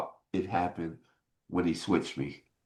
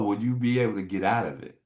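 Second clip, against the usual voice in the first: ordinary talk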